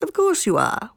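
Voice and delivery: high, mocking fox; lightly snickering storybook fox. A narrator's reading. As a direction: humor them with a mock agreement. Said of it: "snickered the fox".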